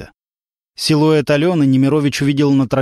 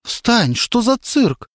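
embarrassed annoyance; 9 semitones higher, much slower than usual. A narrator's reading. Embarrassed girl scolds.

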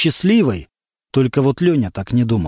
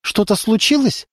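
worried mother; 7 semitones higher than usual. A narrator's reading. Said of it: "почувствовала тревогу".

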